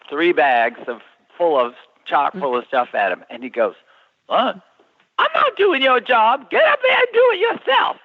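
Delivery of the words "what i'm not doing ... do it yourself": high-pitched, hammed-up outraged retort; indignant guard. Security guard refusing a delivery, mock-indignant outburst. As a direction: imitate a guard indignantly refusing someone else's job. Retold scary guard snapping back.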